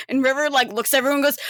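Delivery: ordinary talk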